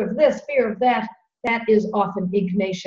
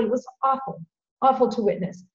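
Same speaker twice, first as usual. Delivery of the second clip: ordinary delivery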